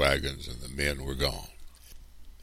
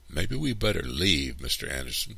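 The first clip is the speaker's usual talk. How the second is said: plain reading voice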